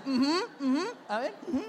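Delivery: high, loud mimicked "uh-huh"; imitating wife. Preacher mimics his wife listening to the lady, high mock uh-huh. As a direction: exaggerate her high-pitched listening noises. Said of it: "Como dice ella".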